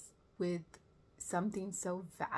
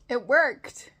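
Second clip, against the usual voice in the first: loud, high triumphant exclamation; 6 semitones higher, 14 dB louder than usual. Retold or imitated. Cheering the bubble gum fix, triumphant. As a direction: burst out with it, punching the word worked.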